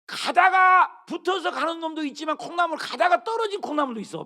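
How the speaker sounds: loud, high preaching shout. Real, not acted. Pastor shouting to the congregation about bean sprouts, loud. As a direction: belt it out to the congregation.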